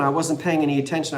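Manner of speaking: ordinary talk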